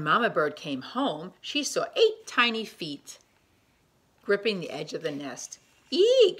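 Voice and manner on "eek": very high, loud shocked eek; shriek of alarm. Acted. Mother bird seeing babies hanging off nest, loud eek. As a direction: squeal in fright at the sight. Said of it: "she cried".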